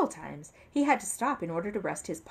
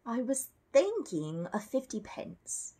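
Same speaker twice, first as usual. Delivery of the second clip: slow, higher and hopeful, a small bear's offer; 6 semitones higher, slower than usual. A narrator's reading. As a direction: ask timidly, drawing it out slowly. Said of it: "said Paddington hopefully".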